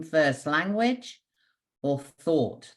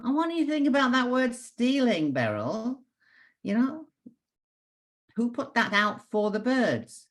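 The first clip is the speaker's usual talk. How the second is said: mother's prompt; 10 semitones higher than usual. Retold or imitated.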